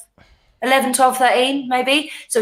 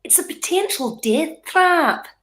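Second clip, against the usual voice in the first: slow, higher, put-on Scottish accent; attempted Scottish accent; 4 semitones higher, slower than usual. Shown as a demonstration. Guest showing the host one more phrase, attempted Scottish accent. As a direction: draw it out in an amateur Scottish accent. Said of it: "Can I say one more thing?".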